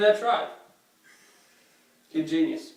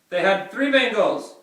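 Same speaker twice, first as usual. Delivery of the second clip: ordinary talk, nothing marked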